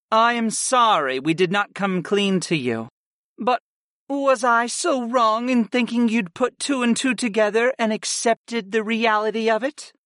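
high-pitched goddess voice, apologizing; apologetic goddess. Acted.

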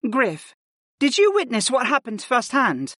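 high, even, businesslike woman's voice; composed healer mage. Acted. Arwen questions Griff and explains her arrival, composed.

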